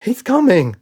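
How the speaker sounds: whining. A narrator's reading. Red panics as the dad approaches, frightened whine. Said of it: "whined Red".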